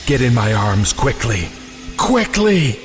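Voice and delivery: ordinary delivery, nothing marked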